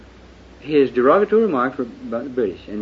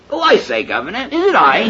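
higher, louder mock British gentleman; mock British accent; 9 semitones higher, 6 dB louder than usual. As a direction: ham up a British officer's accent. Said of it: "this scoundrel, Judas, who spoke up and said".

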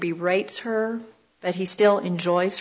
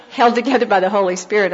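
laughing; faster than usual. Real, not acted. Preacher joking about the student's thin story, laughing. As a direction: laugh through the punchline.

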